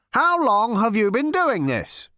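high, loud, slow question; stiff, demanding interrogator; officious interrogation. A narrator's reading. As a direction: put each word to him slowly and forcefully. Zarniwoop continues interrogation.

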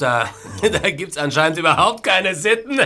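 chuckling through the words; mocking laugh. Gloating villain mocking.

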